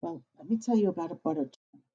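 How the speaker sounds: plain reading voice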